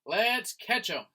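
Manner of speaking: rally the others to catch him; a hint of an angry chase shout; mock shout. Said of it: "shouted someone".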